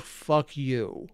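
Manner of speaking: ordinary talk, nothing marked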